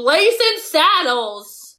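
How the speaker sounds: excited dad. Retold or imitated. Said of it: "He'll be like".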